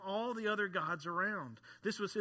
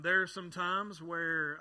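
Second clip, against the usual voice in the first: ordinary talk, nothing marked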